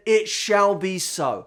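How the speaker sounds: put-on It shall be so said slowly and gravely in a light accent; measured accented vow; slow solemn decree. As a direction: promise with total certainty. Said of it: "forgive the accent".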